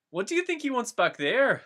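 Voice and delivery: a nosy hobbit wondering aloud; Pippin asking. Acted. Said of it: "Pippin questioned".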